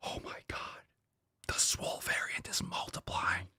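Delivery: hushed whisper of mock dread; mock alarmed whisper. Host reacts to the swole variant post in a hushed whisper. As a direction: whisper it like grim news.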